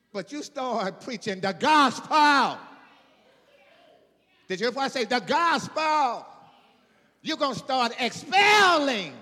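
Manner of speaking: booming sermon climax with stretched words; preaching at full force. Real, not acted.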